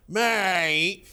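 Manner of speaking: lightly acted telling-off, vowel stretched; warning mate. Showing how to tell people not to do something, mildly warning. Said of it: "is how you tell people not to do something".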